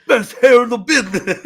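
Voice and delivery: mock wrestler brag. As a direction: crow it like a vain wrestler. Host imitates Briscoe bragging about hair.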